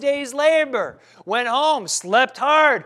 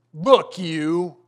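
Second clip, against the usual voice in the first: disdainful; 4 semitones lower, 5 dB louder than usual. Retold or imitated. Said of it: "which is equivalent to".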